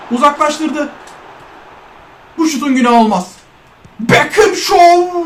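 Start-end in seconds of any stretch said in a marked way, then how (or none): 4.0-5.2 long drawn-out, high-pitched shout; excited commentator shout. Real, not acted. Gamer hailing Beckham's play, excited drawn-out shout. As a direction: stretch the last word out like a commentator.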